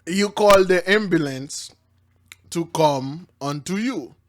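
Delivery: usual speaking voice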